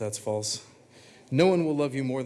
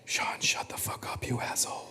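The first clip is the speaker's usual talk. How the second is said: inner voice whisper; 4 dB quieter than usual. Acted. His inner voice telling Sean off in a whisper. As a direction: hiss it like a voice in his head. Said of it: "the inner voice so softly saying".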